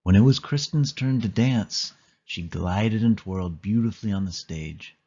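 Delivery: usual speaking voice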